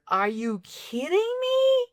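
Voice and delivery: high, slow, incredulous; can't believe the writers did this; exasperated disbelief. Real, not acted. Fan ranting about the movie, exasperated.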